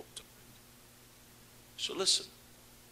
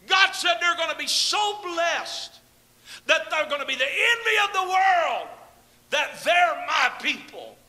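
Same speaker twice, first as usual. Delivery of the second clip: louder, higher shouted proclamation; full-force preaching; 9 semitones higher, 4 dB louder than usual. Acted. Preacher shouting God's promise to his congregation, full force. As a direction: proclaim God's blessing to the whole church.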